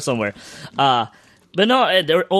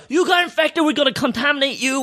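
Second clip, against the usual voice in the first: high, mock-stern announcement; mock official; 10 semitones higher than usual. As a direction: play the staff declaring her infected. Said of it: "And they go".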